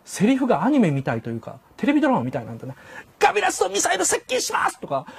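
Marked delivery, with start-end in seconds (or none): 3.2-4.8 high, far-off shouted report like an anime crew; mock anime bridge shout. Mocking anime crew member shouting a missile warning. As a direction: ham up a bridge officer yelling the alert.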